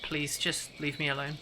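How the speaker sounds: soft, withdrawn plea; softly. Acted. Stranger asking Sir Alan to leave her alone, softly. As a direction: plead quietly, wanting to be left be.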